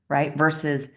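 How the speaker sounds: normal talking voice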